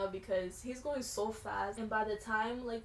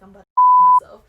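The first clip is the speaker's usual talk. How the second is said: panicked yell; 27 dB louder than usual. Retold or imitated. Panicking on the jet ski.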